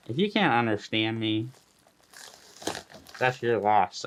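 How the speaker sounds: slurred speech from a numb mouth. Real, not acted. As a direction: talk with a numb mouth after dental work. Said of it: "My mouth is numb".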